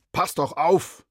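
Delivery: high, loud angry shout; angry driver. Acted. Angry driver yells at boy who ran onto the street. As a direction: yell at the careless boy. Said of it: "Der wütende Autofahrer".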